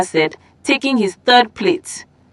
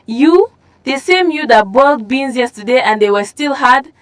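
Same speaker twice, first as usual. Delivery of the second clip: put-on girl scoffing at Chinwe's cooking; scornful; 4 semitones higher than usual. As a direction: cut her rival down with scorn.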